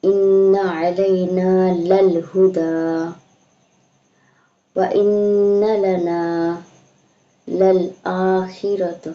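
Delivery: slow, drawn-out chanted recitation; tajweed recitation, slow and measured; Quran recitation. Shown as a demonstration. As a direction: recite it melodically, stretching each vowel. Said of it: "teacher recites the verse as model".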